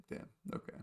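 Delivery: breathless whispered aside; a whispered, stunned little reaction. Retold or imitated. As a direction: say it under your breath, done in. Reacting weakly after the goose.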